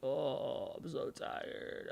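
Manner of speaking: soft, slow, drained voice; tired sigh. Retold or imitated. Imitating himself the tired day, drained and soft. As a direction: sag into the words like no energy. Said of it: "and I'm like".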